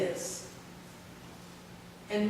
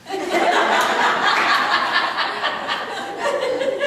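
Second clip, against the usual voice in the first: high, loud burst of real laughter; 7 dB louder than usual. Real, not acted. Laughing at her own confession to the group. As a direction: laugh out loud at the dark joke.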